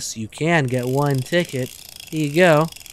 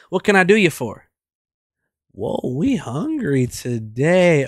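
ordinary talk, nothing marked

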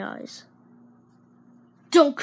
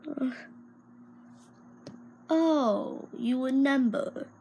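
ordinary talk, nothing marked

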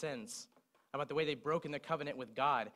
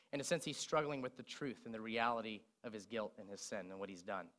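normal talking voice

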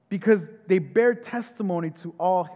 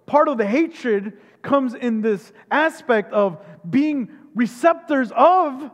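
usual speaking voice

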